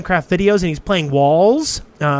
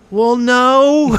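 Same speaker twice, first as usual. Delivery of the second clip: reluctant admission; 9 semitones higher, 4 dB louder than usual. Acted. Acting reluctant answer about purchase.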